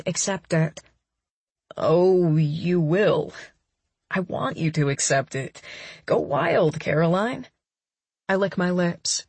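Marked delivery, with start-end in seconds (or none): 1.8-7.5 a hint of a lower, coaxing man; lightly voiced man. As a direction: play the boyfriend, a bit lower. Daniel's lines in story.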